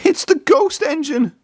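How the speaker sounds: high terrified cry; panicked cry. A narrator's reading. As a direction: shriek the warning. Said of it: "shouted Thomas".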